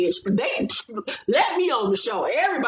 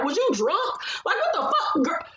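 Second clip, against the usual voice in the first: mock disbelief; 9 semitones higher than usual. Mocking Nene in a retold argument.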